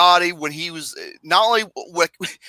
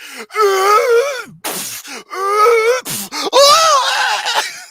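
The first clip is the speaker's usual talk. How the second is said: laughing shout; 4 dB louder than usual. Retold or imitated. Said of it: "he's like".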